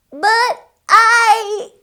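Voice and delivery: high startled "But I"; flustered pig; protesting piglet. A narrator's reading. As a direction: try to object.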